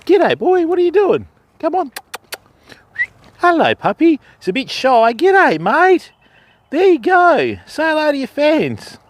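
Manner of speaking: high, sweet greeting to the dog; high dog-talk voice. Real, not acted. Fisherman greeting the farm dog, high baby-talk voice. Coaxing shy dog to camera.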